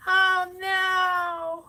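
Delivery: high dismayed cry. Real, not acted. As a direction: react to the ruined color. Paint turned the wrong color.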